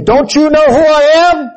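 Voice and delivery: high, loud outraged demand; indignant shout. Retold or imitated. Anger voiced as a proud self, shouting high. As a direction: shout it like wounded pride. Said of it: "Anger says".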